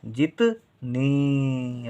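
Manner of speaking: जितनी with the final नी stretched long; long final vowel. Shown as a demonstration. Teacher sounding out the big matra, long final vowel. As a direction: hold the ee at the end of जितनी. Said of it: "ई का उच्चारण देर तक".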